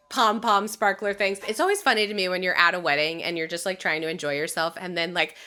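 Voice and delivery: ordinary talk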